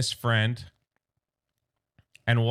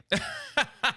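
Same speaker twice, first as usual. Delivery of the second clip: short high burst of laughter; a quick amused laugh; real laugh; 11 semitones higher than usual. Real, not acted. Reviewer laughing at the kids running away.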